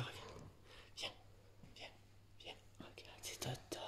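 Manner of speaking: whispered coaxing to a cat; soft whisper to lure the cat. Real, not acted.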